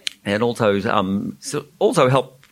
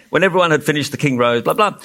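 ordinary delivery, nothing marked

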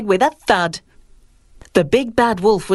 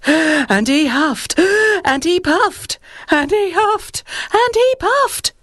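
building strain; 10 semitones higher than usual. A narrator's reading. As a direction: climb higher with every huff, straining. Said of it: "repetition builds".

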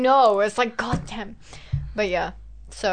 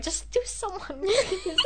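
high, pleading exasperation; mock weary plea to the group; exasperated plea; 10 semitones higher, 5 dB quieter, slower than usual. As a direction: beg them to just act, pitched up. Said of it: "I was just like".

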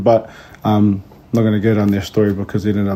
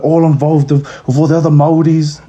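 higher, upbeat teacher voice; encouraging teacher; 6 semitones higher, 5 dB louder than usual. Retold or imitated. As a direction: talk her into it cheerfully. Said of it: "was like".

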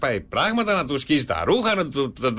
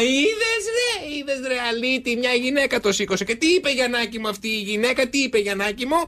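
triumphant wife; 10 semitones higher than usual. Acted. Triumphant wife questions son.

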